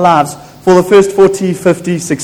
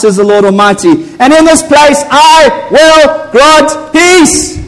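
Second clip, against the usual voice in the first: preaching at full force; 5 semitones higher than usual. Acted. Preacher proclaiming glory and peace over the church, soaring. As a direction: let each promise ring out.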